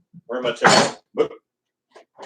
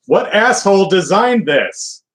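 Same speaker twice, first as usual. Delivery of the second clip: exasperated outburst; 7 semitones higher, 4 dB louder than usual. Real, not acted. Annoyed at flour packaging.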